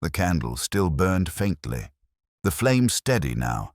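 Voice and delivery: usual speaking voice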